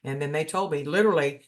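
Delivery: ordinary talk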